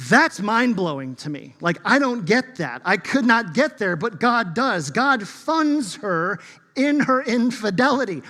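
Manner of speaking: full-force pulpit climax, slowing on the last question; impassioned preaching. Acted. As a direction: preach it with rising amazement at God.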